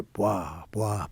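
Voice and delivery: low "bwah" thuds; imitated landing noise. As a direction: mimic wheels meeting the runway. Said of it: "and you hear".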